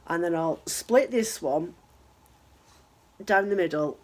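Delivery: hoarse sick voice, a little low; man flu. Real, not acted. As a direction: talk through a heavy cold. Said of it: "I have got man flu".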